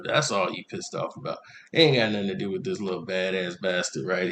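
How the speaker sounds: low, soft grumbling rant; bitter muttering. Retold or imitated. Man grumbling back at the mother, low and soft. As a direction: mutter your grievance under your breath.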